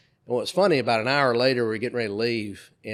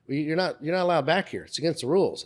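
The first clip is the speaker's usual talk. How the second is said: lightly voiced guard, pitched up; mildly acted reprimand, higher than usual; retold stern guard; 6 semitones higher than usual. Guard telling guest he's not allowed, mildly stern.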